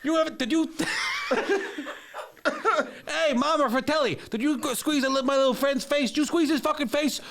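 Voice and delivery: high, brash New York tough guy; Joe Pesci impression. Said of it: "Joe Pesci in The Goonies".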